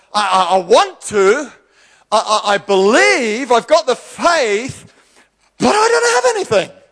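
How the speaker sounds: high, slow, faltering cry of want; stammering lament. Acted. A believer tells God they have no means, high and faltering. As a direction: stumble over the words, straining upward.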